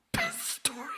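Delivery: very high squeaky echo; mock squeak. Echoing the upcoming story title in a squeaky falsetto. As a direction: squeak it like a cartoon.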